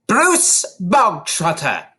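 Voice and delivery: loud, slow barked name. A narrator's reading. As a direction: bark the name out like a sergeant. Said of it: "the Trunchbull barked suddenly".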